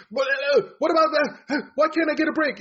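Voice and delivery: high, loud, exasperated mock rant; jittery screaming complaint, imitated. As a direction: whine and shout at the unfairness. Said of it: "he's known as a guy who could".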